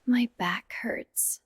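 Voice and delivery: ordinary delivery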